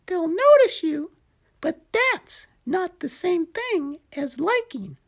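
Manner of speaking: high little gecko explaining. Acted. Gecko voiced by narrator.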